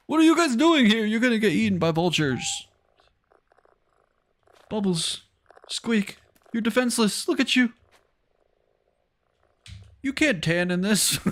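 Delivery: high, cooing fussing at little animals; pet talk. Real, not acted. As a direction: talk to pets in a high playful voice. Fussing over animals in the game.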